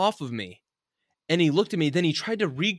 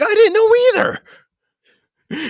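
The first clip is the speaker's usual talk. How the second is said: very high, loud exasperated comeback; yelled retold retort; 12 dB louder, faster than usual. Storyteller retorting to his friend after being grabbed, yelled comeback. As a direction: fire it back as a comic shout.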